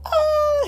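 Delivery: loud, very high-pitched mock scream; sudden piercing "Ah!" at full voice; imitated kid's scream. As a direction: screech like a kid having a tantrum. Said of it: "now he's gonna go".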